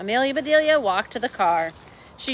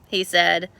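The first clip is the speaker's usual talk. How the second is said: usual speaking voice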